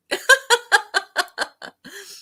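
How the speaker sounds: rising real laughter; helpless amused laugh; high giggle. Real, not acted. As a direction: let the giggle climb. Keeps laughing.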